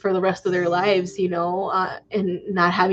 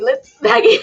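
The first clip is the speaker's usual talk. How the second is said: laughing; 12 semitones higher, 4 dB louder than usual. Real, not acted. Breaks into laughing tears.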